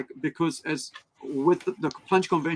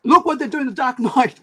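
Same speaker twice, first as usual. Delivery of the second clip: excited exclamation; 9 semitones higher, 5 dB louder than usual. Retold or imitated. Shane's excited retold exclamation.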